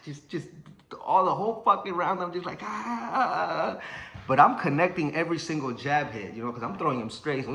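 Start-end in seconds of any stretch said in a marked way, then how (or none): 2.6-3.8 long, drawn-out high "ah"; frantic mock wail. Boxer retelling his frantic first round, high wail. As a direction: act out a panicked cry while punching.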